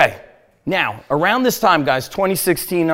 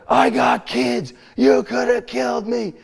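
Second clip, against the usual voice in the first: retold screaming; 7 semitones higher than usual. Driver confronting the man who nearly killed him, mock scream. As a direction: imitate an outraged scream, pitched up, not full volume. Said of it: "I started screaming at him".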